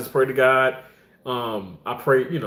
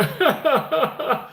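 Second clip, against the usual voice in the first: high-pitched giggling laugh; real laugh; 12 semitones higher than usual. Real, not acted. Host amused while greeting a viewer, laughing.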